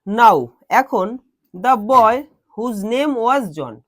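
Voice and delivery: very slow, measured reading with pauses; slow classroom dictation; word by word. Shown as a demonstration. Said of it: "word by word বলছি".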